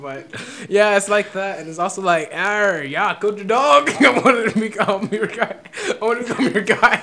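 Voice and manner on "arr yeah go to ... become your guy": high, loud, laughing pirate growl "Arr"; laughing hammed-up pirate voice; mock pirate. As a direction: ham up a pirate while giggling. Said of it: "went from Irish to pirates".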